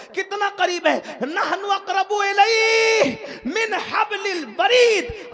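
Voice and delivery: loud, high, slow recitation with a laugh; ecstatic shout. Acted. Preacher crying out the verse to the gathering in ecstasy. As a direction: cry out the verse in joy.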